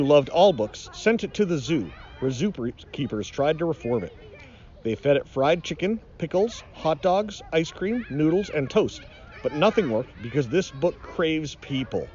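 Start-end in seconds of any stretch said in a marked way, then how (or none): none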